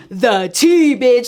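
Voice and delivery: loud, delighted reveal of juicy gossip; excited. Retold or imitated. Telling coworker she's got the tea, loud and thrilled. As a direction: belt it out like you just got the gossip.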